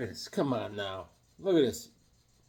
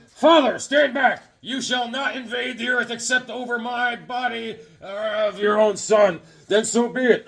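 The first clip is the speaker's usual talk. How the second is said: comic-book hero declamation; 8 semitones higher than usual. Acted. Acting Son of Satan defying his father, melodramatic declamation. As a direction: declaim it like a hammed-up comic cover. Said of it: "on the cover, he's going".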